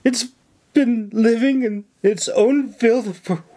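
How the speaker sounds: high, fretful mock lament; hammed-up distress. A narrator's reading. Nora working up the governor with fake woe. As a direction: pile on the misery.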